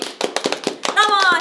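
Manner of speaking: high, loud, very slow hello; drawn-out greeting. Acted. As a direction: stretch the greeting out brightly. Podcast self-introduction.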